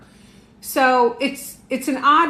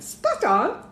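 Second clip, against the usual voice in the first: British accent; 7 semitones higher than usual. Shown as a demonstration. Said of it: "Gotta say that with a British accent".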